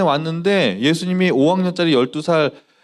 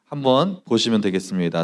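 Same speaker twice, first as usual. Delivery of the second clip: ordinary talk, nothing marked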